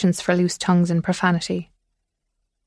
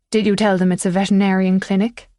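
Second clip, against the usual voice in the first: plain reading voice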